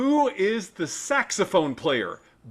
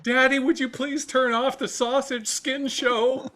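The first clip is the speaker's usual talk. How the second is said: lightly voiced kid, pitched higher; pretend child; 7 semitones higher than usual. Child asking Daddy about the TV, lightly acted high plea. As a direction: plead like a child in a mock-polite request.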